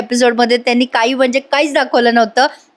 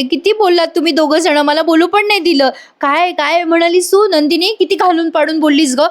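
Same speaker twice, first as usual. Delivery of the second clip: higher-pitched acted indignation; indignant complaint; 6 semitones higher than usual. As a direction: play Arya telling them off, pitched up. Arya upbraids the other two.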